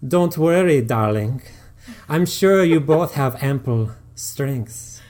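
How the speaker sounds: put-on slow, loud terrible Eastern European accent; hammed-up Eastern European seductress; deliberately awful Eastern European accent; bad Eastern European accent. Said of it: "the worst Eastern European accent he's ever heard".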